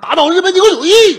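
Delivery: full-throated slogan shout. Acted. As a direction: shout the slogan with patriotic conviction. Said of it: "您喊这个倒对了". (临死前振臂高呼口号)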